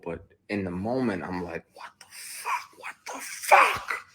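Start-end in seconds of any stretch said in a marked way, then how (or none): 1.7-2.8 slow, softer breathless whisper; stunned whisper. Retold or imitated. Retold stunned reaction. 2.8-4.1 slow, louder forced whisper. Retold or imitated. Retold stunned reaction.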